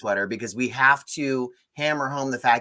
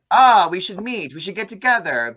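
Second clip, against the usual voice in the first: higher, louder eager invitation; a hint of the smooth love interest; lightly voiced suave Italian; 6 semitones higher, 6 dB louder than usual. Host imitating the Italian hero inviting Lena, eager. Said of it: "And he's like".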